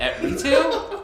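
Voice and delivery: high squeak of laughter; laughing. Real, not acted. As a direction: break into laughter on the words. Laughs mid-word.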